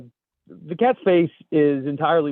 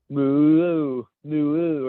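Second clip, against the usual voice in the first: put-on drawn-out moo with a Canadian accent; Canadian cow moo; 4 dB louder than usual. Host reads Canadian flag cow in meme, Canadian-accented moo. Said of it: "The Canadian flag cow".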